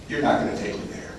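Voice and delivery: very soft, low retold protest; hushed refusal. Newcomer refusing a ride to his parents, hushed. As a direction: say it under the voice, ashamed.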